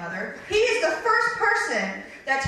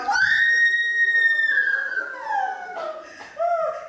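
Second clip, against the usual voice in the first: high shrieking terrified laugh-scream; 3 dB louder than usual. Retold or imitated. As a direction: scream out in panic from the back seat. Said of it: "I was in the back seat going".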